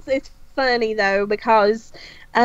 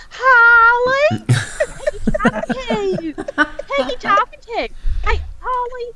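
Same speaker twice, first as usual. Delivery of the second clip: nosy friend voice; 10 semitones higher than usual. Retold or imitated. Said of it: "this bitch will be like".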